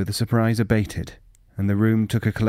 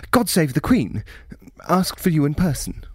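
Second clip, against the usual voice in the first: higher, sudden patriotic outburst; blurted; 8 semitones higher than usual. Acted. Official blurting a royal toast at the pub. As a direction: blurt it like a spring snapped loose. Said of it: "blurted mid-sentence".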